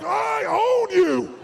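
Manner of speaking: loud, high, slow I own you; shouted challenge. Shown as a demonstration. Coach showing the crowd a challenge, loud shout. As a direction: bellow it at them as a dare. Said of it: "No, no, no. I mean, say like".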